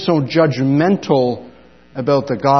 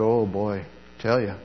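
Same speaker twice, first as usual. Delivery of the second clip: much softer, lower thought to himself; inner grumble; 7 semitones lower, 11 dB quieter than usual. Retold or imitated. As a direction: mutter the private judgment under the breath. Inner thought about new student.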